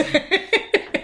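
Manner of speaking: light high-pitched giggle. Real, not acted. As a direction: giggle at the joke. Laughs at creaky voice joke.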